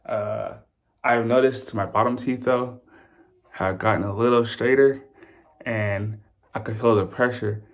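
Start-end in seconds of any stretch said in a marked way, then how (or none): 2.4-7.6 talking with fingers in mouth; lips pulled apart by fingers. Real, not acted. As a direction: lips held open while speaking. Seen 1: Both hands hold lips apart showing braces throughout the labelled words.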